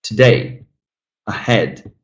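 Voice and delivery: "Today, ahead" stressed on DAY and HEAD; second-syllable stress. Shown as a demonstration. Teacher showing students second-syllable stress in "Today, ahead". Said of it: "Now the second syllable stressed".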